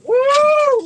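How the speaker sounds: high loud joyful whoop; excited whoop. Real, not acted. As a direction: whoop with delight. Excited whoop over big fish.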